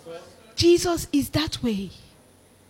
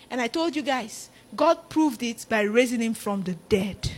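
ordinary talk

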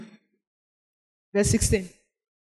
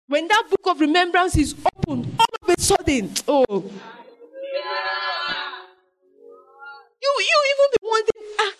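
high, urgent preaching over music; fervent preaching; 7 semitones higher than usual. Acted. Preacher promising the congregation a future, fervent. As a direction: lift them toward hope.

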